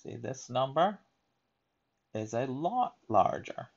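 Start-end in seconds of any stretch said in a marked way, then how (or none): none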